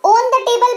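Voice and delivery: "on" in "on the table" said like own; mispronounced long o. Retold or imitated. Teacher imitating people who misread "on" as own. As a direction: round the "on" into a long o. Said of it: "कई लोग पढ़ते हैं इसको".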